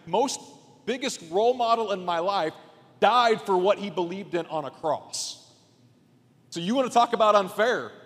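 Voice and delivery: high, loud preacher's push, driving each line home; full-force preaching. Acted.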